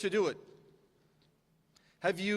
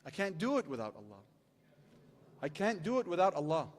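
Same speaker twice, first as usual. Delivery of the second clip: quiet repetition; 4 semitones lower, 6 dB quieter than usual. Shown as a demonstration. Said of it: "Say it with me".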